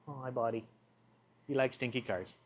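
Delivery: soft, fond pet talk. Real, not acted. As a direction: coo to the cat. Talking to the cat.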